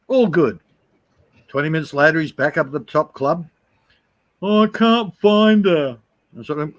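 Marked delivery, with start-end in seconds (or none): 4.4-6.0 slow, higher mock lament; mopey. Mike returning without the girl, slow mock whine. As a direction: drag it out forlornly. Mate coming back sad.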